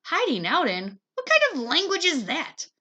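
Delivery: high, loud scoffing question; mocking disbelief. Sister mocks little brother's grammar, high and loud. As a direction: pick apart his grammar with scorn.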